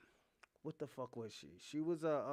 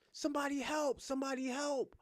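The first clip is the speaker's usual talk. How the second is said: mock cry for help; 9 semitones higher than usual. Daughter calling for help, high-pitched mock plea. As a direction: imitate a panicked plea, pitched up. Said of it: "she was like".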